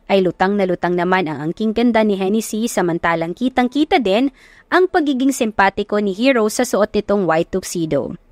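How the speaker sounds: plain reading voice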